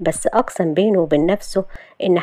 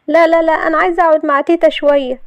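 high, insistent refusal; protesting; 10 semitones higher, 4 dB louder than usual. Acted. Shahd refusing to leave grandma, protesting. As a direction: pitch it way up and refuse quickly.

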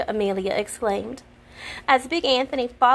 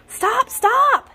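high, loud yell; frantic yelling to calm her; 14 semitones higher, 7 dB louder than usual. A narrator's reading. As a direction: stop her short with a yell. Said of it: "yelled Big Anthony".

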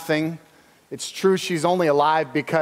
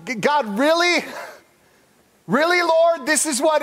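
pitched-up pleading disbelief; crying out to God; 7 semitones higher than usual. Retold or imitated. Elijah to God over the dead son, high disbelieving plea. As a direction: plead upward in high exasperation. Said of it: "He cries out to God".

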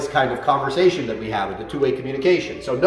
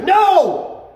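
loud, much higher No! at a reactive dog; shouted no; 6 dB louder than usual. Shown as a demonstration. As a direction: stop the leash-reactive dog cold. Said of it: "Let him know".

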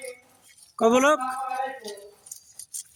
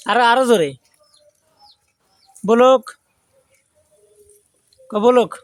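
loud coaching call; 3 semitones higher, 4 dB louder than usual. Real, not acted. Vlogger loudly drilling his goat to say blog, loud call. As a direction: coax a goat to say the word, loud.

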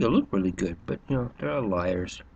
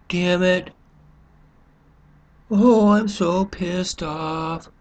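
hammed-up grumbling TV viewer, higher and louder, dragging slowly; mock couch potato; 6 semitones higher, 4 dB louder, slower than usual. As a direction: play a lazy viewer faking outrage, then flipping channels. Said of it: "We sit there and go".

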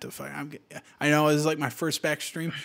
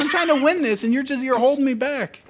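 frustrated; 9 semitones higher, 7 dB louder than usual. Real, not acted. Heated banter with teammate.

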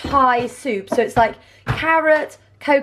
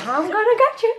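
menacing cartoon toe; 9 semitones higher than usual. Voicing a drawn toe.

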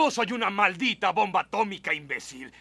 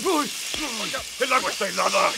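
cold shock; 5 semitones higher, 3 dB louder than usual. Real, not acted. Cold hose water hits him.

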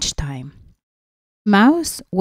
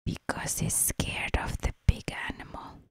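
whisper; 10 dB quieter than usual. Real, not acted. Whispered about the fox.

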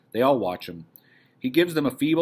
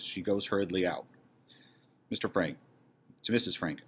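normal talking voice

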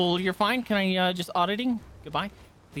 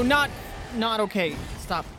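usual speaking voice

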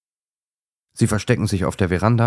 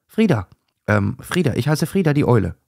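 higher, tumbling, correcting herself; flustered intro; 7 semitones higher, 4 dB louder than usual. A narrator's reading. Owl flustered as she introduces herself, excited. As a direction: stumble over your own name.

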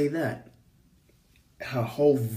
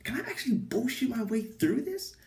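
inner doubt; 10 semitones higher than usual. Acted. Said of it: "in my head".

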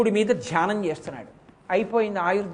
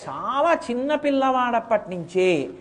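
normal talking voice

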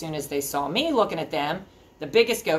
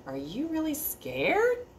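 higher, slow, softer surprised question; gentle surprise; 11 semitones higher, 4 dB quieter, slower than usual. Acted. Troll realizing the big goat is afraid, soft and amazed.

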